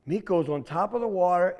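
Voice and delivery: usual speaking voice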